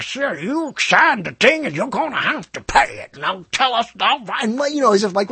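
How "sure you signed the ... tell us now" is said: put-on high voice of a hard old woman; ragged old hag. Company woman telling the customer to pay, mock old hag. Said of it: "this was the ragged old hag I ever heard voice".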